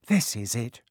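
plain reading voice

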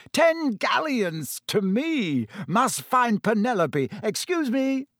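high-pitched, pleased exclaiming; delighted. A narrator's reading. Percy after winning his bet, delighted. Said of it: "looking delighted".

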